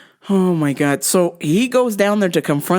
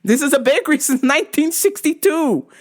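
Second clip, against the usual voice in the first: lightly voiced bakery lady; 7 semitones higher than usual. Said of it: "the lady's like".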